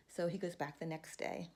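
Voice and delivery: ordinary delivery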